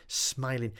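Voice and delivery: ordinary delivery, nothing marked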